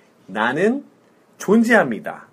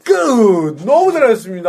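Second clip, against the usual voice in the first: excited praise; 8 semitones higher, 7 dB louder than usual. Real, not acted. Teacher praising student after first lesson, excitedly. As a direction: celebrate the student's success loudly.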